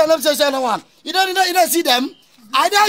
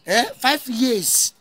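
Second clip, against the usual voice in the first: dragged out; 2 semitones lower, 3 dB quieter, much slower than usual. Acted. Dragged out complaint.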